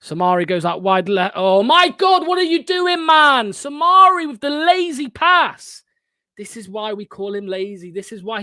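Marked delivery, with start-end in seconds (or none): 1.3-5.8 much higher and louder, disbelieving; exasperated outburst. Real, not acted. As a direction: yell at the screen in frustration. Fan reacts to a lazy pass.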